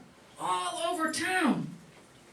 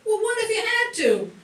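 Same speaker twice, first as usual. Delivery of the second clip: teasing; 7 semitones higher, 6 dB louder than usual. Acted. Brother teasing sister.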